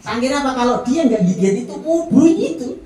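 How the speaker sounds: lifting the crowd with rising fervour; excited, rising preacher. Real, not acted. Climax of the sandal story.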